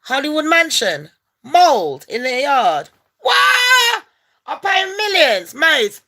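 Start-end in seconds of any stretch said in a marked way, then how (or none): 3.2-6.0 high, loud shout of disbelief; sudden outraged squawk; shocked shout. Acted. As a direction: yell it like you can't believe it. Mock outrage at mansion mold.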